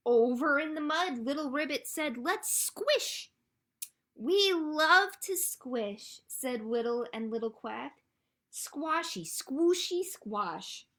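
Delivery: loud, high, playful read-aloud; animated storytime reading. A narrator's reading. Librarian reads friends squishing in the mud, animated storytime delivery. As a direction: relish the squishy words for young listeners.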